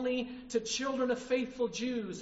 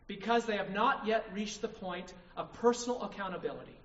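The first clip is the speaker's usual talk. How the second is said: ordinary talk, nothing marked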